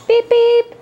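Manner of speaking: high, loud playful beeping; silly clown. Pretend. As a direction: toot like a toy car horn. Playful horn sound for baby.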